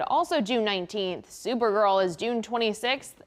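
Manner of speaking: ordinary talk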